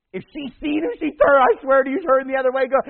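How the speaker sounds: high, loud, incredulous telling; excited exclamation. Retold or imitated. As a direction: shout it out in disbelief. Amused retelling of avoiding.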